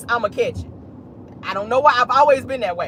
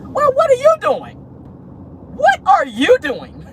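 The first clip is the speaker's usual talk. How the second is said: much higher, drawn-out disbelief; sassy what-are-you-doing; incredulous retort; 13 semitones higher, slower than usual. Real, not acted. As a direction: call out the hypocrisy, slowing the repeat. Retort to hypocritical rival.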